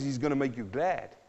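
ordinary talk, nothing marked

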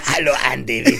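mock South African accent, breaking into laughter; hammed-up South African statesman greeting; Mandela. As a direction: do a daft South African elder voice. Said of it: "Like Mandela".

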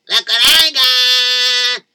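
loud, very slow drawn-out offer; slow coaxing call. Acted. Zalmi holding out sugar cubes to the horse, loud and slow. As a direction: stretch it out to lure the horse.

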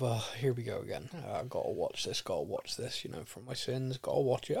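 soft, low resigned groan of a fan; weary dread. Retold or imitated. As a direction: sigh through it like a long-suffering fan. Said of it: "with that feeling of".

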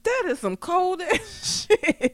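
high, slow retold disbelief with a laugh; shocked reaction. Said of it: "I was like".

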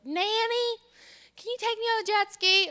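put-on high, loud pleading child voice; little girl. Eight-year-old begging for jet ski ride, high child voice. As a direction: imitate an eager child begging grandma.

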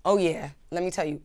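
usual speaking voice

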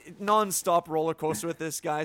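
ordinary talk

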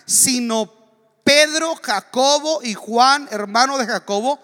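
loud, high sermon delivery; full-force preaching. Acted. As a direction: preach it to the whole church. Preaches the Bible passage.

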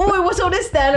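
jokey Cockney copper line; overdone British accent; mock British accent. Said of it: "what British people say".

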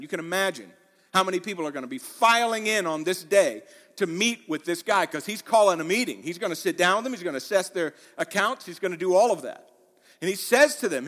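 normal talking voice

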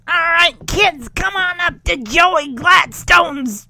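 hammed-up mad scientist shout. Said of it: "mad scientist impression here".

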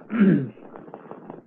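sharp cough to clear the throat. Real, not acted. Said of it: "something went down the wrong pipe".

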